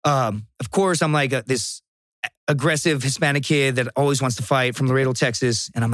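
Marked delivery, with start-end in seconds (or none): none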